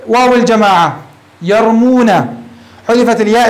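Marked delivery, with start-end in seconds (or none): none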